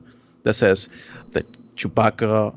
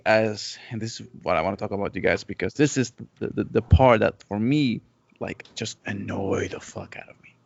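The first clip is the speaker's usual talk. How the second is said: ordinary delivery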